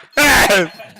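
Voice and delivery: big burst of laughter; loud laugh. Real, not acted. Laughing after joke in chat.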